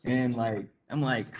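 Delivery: ordinary talk, nothing marked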